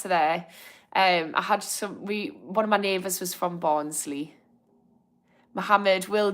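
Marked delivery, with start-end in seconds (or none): none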